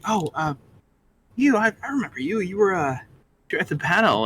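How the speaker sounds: light, quick excited voice; eager Harley. Acted. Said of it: "Harley ... was like".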